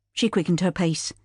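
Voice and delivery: plain reading voice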